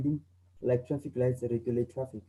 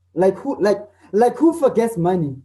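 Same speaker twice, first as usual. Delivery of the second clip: higher and louder, incredulous; surprised disbelief; 4 semitones higher, 7 dB louder than usual. Shown as a demonstration. Teacher acting out disbelief about forgotten money. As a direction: ask it like you can't believe it.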